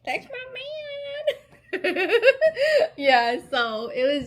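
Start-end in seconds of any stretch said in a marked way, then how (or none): 0.0-1.4 very high and slow, laughing through it; proud laughing. Real, not acted. Proud wife cheering her husband at the ceremony, high laughing. 1.7-2.9 loud, high burst of laughter. Real, not acted. Proud wife laughing at her husband's honor, loud high laugh.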